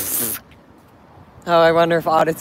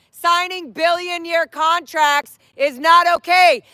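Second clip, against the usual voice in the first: raised-voice public denunciation; street protest speech; 8 semitones higher, slower than usual. Real, not acted. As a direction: project it forcefully to people across the street. Shouts protest messages at passers-by.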